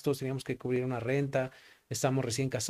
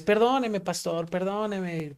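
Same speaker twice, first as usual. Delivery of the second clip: lightly voiced woman begging, higher pitched; mock plea to a pastor, last word drawn out; a hint of a higher, apologetic woman's voice; pleading woman; 5 semitones higher, slower than usual.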